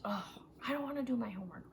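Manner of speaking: fast, soft whine; mock whiny kid. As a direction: grumble like a kid stuck with homework. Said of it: "I would feel angry more often".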